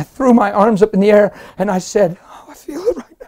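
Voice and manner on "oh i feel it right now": real high, breaking, softened exclamation; overcome. Real, not acted. Preacher feeling the moment return mid-story, overwhelmed. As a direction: gasp it out, suddenly overcome. Overcome while retelling his experience.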